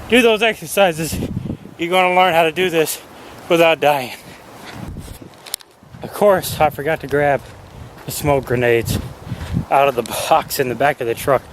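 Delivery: ordinary talk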